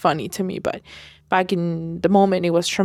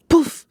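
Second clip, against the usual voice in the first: imitated pop noise; 9 semitones higher, 4 dB louder than usual. Woman mimicking the pop she felt at her ear, puffed sound. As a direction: puff the air like a burst by your ear.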